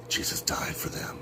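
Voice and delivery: gasp, then hushed whisper; breath-only, soft pretend awe; mock awed whisper. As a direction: whisper it in sham reverence.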